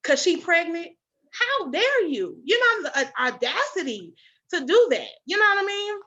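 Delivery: high-pitched indignant re-enactment; outraged rebuke. Retold or imitated. Retelling her rebuke of the judgmental nurse, outraged. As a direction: swoop up in righteous outrage.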